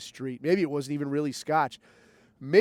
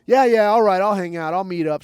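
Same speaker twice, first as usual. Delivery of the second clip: eager yes; 2 semitones higher, 8 dB louder than usual. Retold or imitated. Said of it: "And I'm like".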